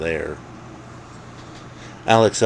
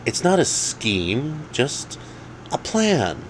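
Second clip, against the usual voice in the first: higher, slow character voice; Alex's voice; 9 semitones higher, slower than usual. Acted. Alex correcting Dave, slow and higher. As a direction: ease it out like a careful correction.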